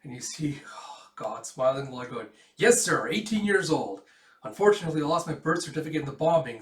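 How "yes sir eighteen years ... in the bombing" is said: eager. Acted. Underage boy lies to recruiter.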